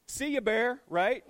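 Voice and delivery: loud comic goodbye to Bear; dismissive send-off. Acted. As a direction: wave the boyfriend out the door. Dad dismissing boyfriend.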